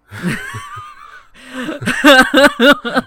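loud, high helpless laugh; cracking up at the irony; real loud laughing fit; burst of laughter. Real, not acted. Reacting to awkward persona name.

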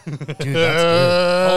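laughing. Real, not acted. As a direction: laugh through the words. Laughing reaction to beer.

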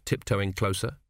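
ordinary delivery, nothing marked

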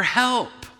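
high call for help; yell for help. Shown as a demonstration. Preacher demonstrating a clerk calling for help. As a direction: call out for help after a theft. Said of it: "appropriate to yell".

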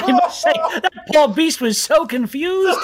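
high, slow chuckling professor; laughing professor. Acted. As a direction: chortle through the boast. Professor laughing at own story.

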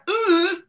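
high, loud "uh" of disbelief; stunned grunt. Retold or imitated. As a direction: let out a loud, baffled "uh". Acted stunned reaction to silly answer.

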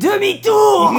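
high, loud shout cracking into laughter; laughing shout. Retold or imitated. Tipsy host blurting the film quote, laughing shout. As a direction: yell it while cracking up.

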